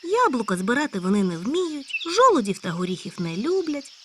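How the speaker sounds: high loud eager hare; a hare bursting with news. Acted. Hare character voice. (високий гучний схвильований заєць)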